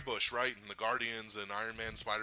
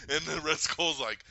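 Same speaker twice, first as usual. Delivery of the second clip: ordinary talk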